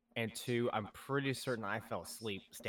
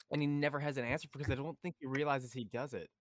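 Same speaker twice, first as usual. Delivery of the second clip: ordinary delivery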